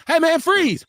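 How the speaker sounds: hammed-up police order; mock cop command. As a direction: play a TV cop yelling freeze. Acting out a cop.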